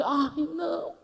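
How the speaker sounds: high coy refusal; lightly high old lady voice. Retold or imitated. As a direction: play the shy old woman.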